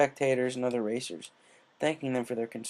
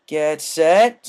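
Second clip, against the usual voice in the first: louder clipped starting call; loud starter call; 5 semitones higher, 13 dB louder than usual. Acted. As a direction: call it down the line. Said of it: "Spike continued".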